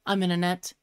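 low, quiet, sinking realization; deadpan dismay. Acted. Squirrel finds itself caught in a net, deflated. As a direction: drop low and soft, slowing on the repeat.

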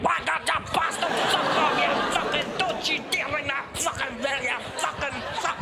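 high-pitched, hammed-up outraged swearing; going nuts. Scottish drinker reacting to last orders bell, going nuts. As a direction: rant furiously in a mock Scottish pub frenzy. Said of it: "we go nuts".

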